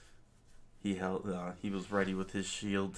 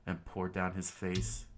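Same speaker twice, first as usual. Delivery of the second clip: ordinary delivery, nothing marked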